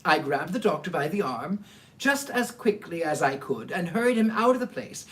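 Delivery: ordinary delivery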